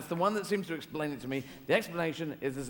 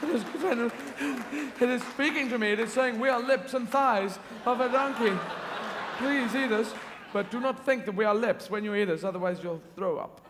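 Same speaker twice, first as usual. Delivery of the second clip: higher, louder, overcome with wonder; awestruck gushing; 6 semitones higher, 5 dB louder than usual. Retold or imitated. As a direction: swoon over a hot dog like it's a miracle. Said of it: "you'd be going".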